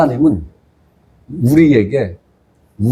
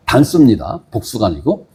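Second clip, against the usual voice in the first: normal talking voice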